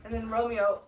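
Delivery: ordinary delivery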